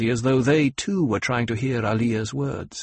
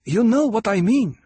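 insistent Fremen woman; 11 semitones higher, 6 dB louder than usual. Acted. Hera pressing her point.

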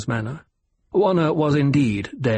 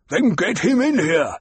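Baron voice; 7 semitones higher, 3 dB louder than usual. A narrator's reading. Baron orders Piter.

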